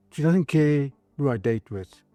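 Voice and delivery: ordinary talk, nothing marked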